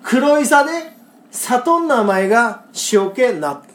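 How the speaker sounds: again slow Kagoshima dialect, steady and clear; Kagoshima dialect recital. Shown as a demonstration. Host repeating the Kagoshima senryu for co-host, slow Kagoshima dialect.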